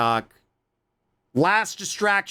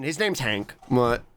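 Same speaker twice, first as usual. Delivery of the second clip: normal talking voice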